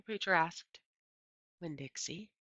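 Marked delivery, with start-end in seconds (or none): 1.6-2.3 hushed and nervous; whispered. A narrator's reading. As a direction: breathe the name so no one gets mad. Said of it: "I whispered".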